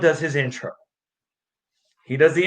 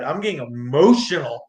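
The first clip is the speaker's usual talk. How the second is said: ordinary delivery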